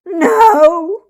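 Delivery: high, loud crying scream. A narrator's reading. Girl screaming at Mama in a fit, crying. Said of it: "I screamed as loud as possible".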